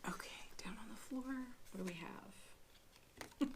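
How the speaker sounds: a soft, unvoiced whisper to herself. Real, not acted. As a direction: drop to a breathy whisper while fetching the card. Reaching for card on floor.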